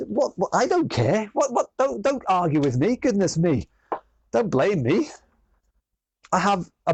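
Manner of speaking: high, put-on huffy protest; hammed-up defensive squeal; mock indignant.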